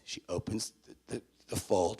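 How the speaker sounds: ordinary talk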